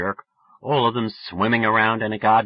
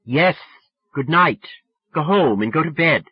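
put-on louder, higher firm girl; exasperated dismissal; 6 semitones higher, 5 dB louder than usual. A narrator's reading. Sally saying good night to drunk friend, exasperated. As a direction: send him away firmly.

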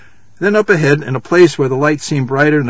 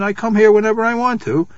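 high-pitched, surprised assertion; lightly surprised, higher voice; 7 semitones higher than usual. A narrator's reading. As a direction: insist on it, pitched up in mild surprise. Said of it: "surprised by the question".

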